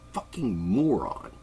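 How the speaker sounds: ordinary delivery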